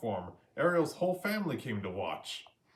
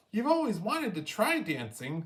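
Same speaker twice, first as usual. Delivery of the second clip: higher, bright fish voice; Flounder voice; 8 semitones higher than usual. A narrator's reading. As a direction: urge a friend not to quit. Flounder encourages.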